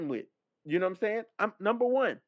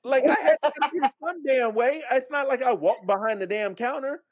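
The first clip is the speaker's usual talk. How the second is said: raised, heated justification; indignant self-defense; 6 semitones higher than usual. Retold or imitated. Traveler defending going around the rope, indignant. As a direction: protest the unfair accusation. Keeps arguing his case, retold.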